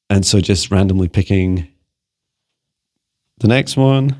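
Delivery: normal talking voice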